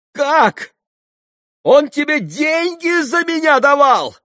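very high, loud shriek of disbelief; hysterical cry. A narrator's reading. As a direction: scream the question in hysterics. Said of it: "истерически вскричала Грушенька". (истерический вскрик)

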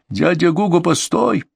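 high-pitched call at moderate volume. A narrator's reading. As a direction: cry out for someone to wait. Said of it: "крикнул Дмитрий". (окликает высоким голосом)

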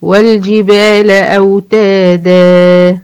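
slow recitation holding the final alif; teacher recites the verse to show the madd; Quran recitation. Shown as a demonstration. تلاوة بطيئة مع مد الألف. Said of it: "علشان الدال دي كانت منونة".

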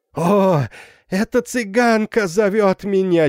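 high, loud manic laughing cry; wild laughing scream. A narrator's reading. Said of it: "дико смеясь закричала она".

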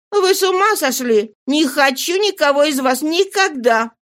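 high-pitched, incredulous woman; Nana, astonished scorn. Acted.

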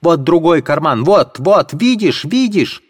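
high-pitched defiant cry; shouting. Acted. Katerina daring anyone to search, high shrill shout. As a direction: shriek it at the whole room.

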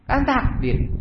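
high, falling little protest; put-on high voice; high-pitched excuse. Excuse thrown back, pitched high.

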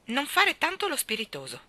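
high, sharp telling-off; stern rebuke. A narrator's reading. Mary Poppins snubbing Michael's joke, sharp and stern. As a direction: put the cheeky boy in his place. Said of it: "con un tono che tolse a Michael ogni desiderio".

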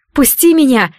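high, loud cry to break free; shouting. A narrator's reading. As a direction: yell to be let go. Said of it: "закричала она". (крик)

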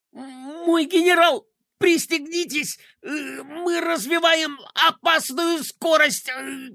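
high, slow strained voice; straining adjutant. Acted. Adjutant warning the general while straining, high strained voice. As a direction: grunt it out with effort while pushing. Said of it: "кряхтел адъютант".

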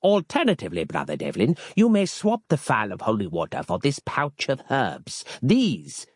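pompous holy man offering a relic; a hint of a grave medieval brother; archaic monk Harland. A narrator's reading.

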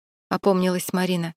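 usual speaking voice

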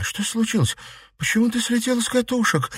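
very high, thin-leveled scared voice; frightened questions. A narrator's reading. Girl asking why her friend snapped, frightened high voice. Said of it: "испуганно спросила Марина".

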